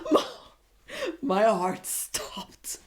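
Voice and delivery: slow, voiceless whisper; breathless whisper. Real, not acted. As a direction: let it out like you can barely breathe. Swooning at Tyler's line.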